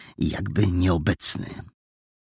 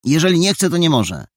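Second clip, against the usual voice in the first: high, loud bristling reply; small boy defending his friend; indignant retort; 10 semitones higher, 5 dB louder, faster than usual. Acted. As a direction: snap back in his defence. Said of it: "żachnął się".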